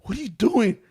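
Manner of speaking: high, loud startled yelp. Retold or imitated. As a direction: imitate a startled wake-up. Imitating startled partner.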